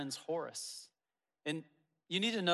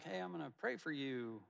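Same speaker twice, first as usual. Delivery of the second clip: breezy throwaway promise, a touch softer; corny; 7 dB quieter than usual. Retold or imitated. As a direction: toss it off like a cliché. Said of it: "one of those corny".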